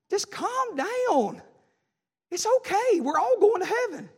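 high, very slow mocking coo; mocking a smug churchgoer; mock soothing complainer. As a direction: drag out the calm-down like a condescending friend. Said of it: "they said this".

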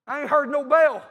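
loud, high challenge from the floor; defiant holler. Retold or imitated. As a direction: shout it back, not beaten yet. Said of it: "And old Rocky hollers".

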